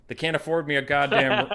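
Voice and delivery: put-on complaining uncle in a Southern accent. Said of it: "You're doing the Southern accent".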